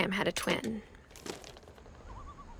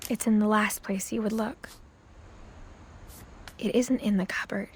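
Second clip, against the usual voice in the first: whisper; 3 dB quieter than usual. Acted. Secretive whisper about bathroom secret.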